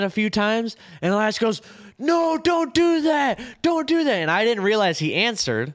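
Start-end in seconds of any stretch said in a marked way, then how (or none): 2.0-4.1 high, drawn-out mock protest; retold protest. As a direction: imitate a roommate's urgent objection, pitched way up. Retold frantic objection from roommate.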